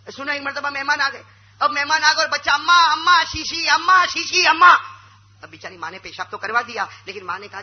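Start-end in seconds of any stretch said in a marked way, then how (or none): 2.5-4.9 loud, high, urgent calling of amma; child shouts for mother in front of guests. Retold or imitated. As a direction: yell for mother, can't hold it.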